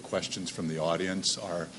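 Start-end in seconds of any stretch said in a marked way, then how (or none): none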